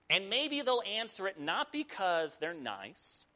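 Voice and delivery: ordinary talk, nothing marked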